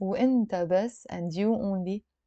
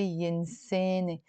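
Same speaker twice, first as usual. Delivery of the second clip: Lebanese 'eh' vowels, 'yinseh-neh' for ينساني; Lebanese accent; 5 dB quieter than usual. Shown as a demonstration. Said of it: "In the Lebanese accent".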